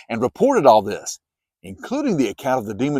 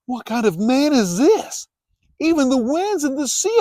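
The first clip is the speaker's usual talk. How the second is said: amazed; 13 semitones higher than usual. A narrator's reading. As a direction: marvel at what you just saw. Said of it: "The men were amazed and asked".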